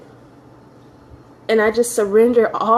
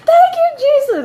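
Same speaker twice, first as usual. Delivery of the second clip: very high-pitched, wailing thanks; mock tearful shrieking of gratitude, far above normal pitch; imitating rejoicing mom. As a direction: cry out praise like an overjoyed mother. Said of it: "I heard my mom on the".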